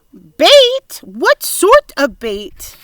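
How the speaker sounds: high, loud, shocked question; alarmed question. A narrator's reading. Earthworm alarmed at talk of bait, high and loud. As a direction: repeat it in alarm.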